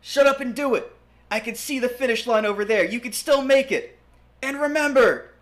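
high, loud shout over wind. Acted. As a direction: bellow it over rushing wind. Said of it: "Edward shouted over the rushing wind".

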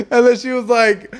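usual speaking voice

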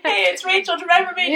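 high sing-song hello; mock chirpy reintroduction. Greeting her ex-date at drinks, high mock-chirpy. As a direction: play it falsely bright. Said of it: "group drinks and be like".

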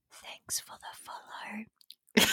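slow unvoiced whisper; ASMR whisper. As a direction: breathe it softly into the mic. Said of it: "I'll just hear this".

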